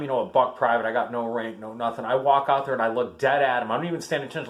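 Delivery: ordinary delivery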